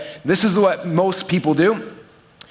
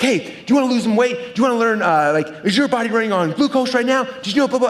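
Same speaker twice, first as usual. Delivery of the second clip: higher-pitched pushy hype, a mock yell; mock sales yell; 6 semitones higher than usual. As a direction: imitate the overexcited spandex crowd pitching strangers. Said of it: "they yell at them like".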